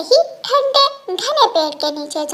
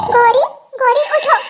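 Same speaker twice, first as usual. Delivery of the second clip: raised calling voice, higher and louder; calling to wake; 6 semitones higher, 3 dB louder than usual. Acted. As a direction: call out to wake her up. Bird wakes sleeping crow. (ऊँची आवाज़ में जगाना)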